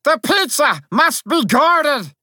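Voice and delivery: high, forceful little soldier's command; very high dramatic alarm; urgent cry. A narrator's reading. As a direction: pipe out an urgent order. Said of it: "I cried".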